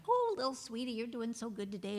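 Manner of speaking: soft, loving coo to yourself; tender self-talk. Retold or imitated. Speaker recording loving messages to herself, in a soft tender coo. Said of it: "say loving things to yourself".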